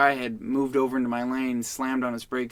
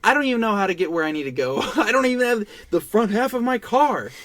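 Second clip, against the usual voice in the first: high, loud, furious comeback; telling off the driver who wants a rental car, high and loud; incredulous angry retort; 9 semitones higher, 5 dB louder than usual. Retold or imitated. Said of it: "he's sitting there screaming at me".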